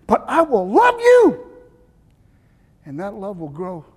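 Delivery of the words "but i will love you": higher, lifted tender declaration; a loving vow sung out high; tender promise. Acted. Jesus promising the preacher his love, tender and high.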